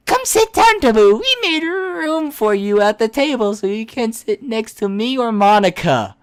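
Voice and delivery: put-on high, eager anime girl; bubbly invitation to sit; cheerful girl voice.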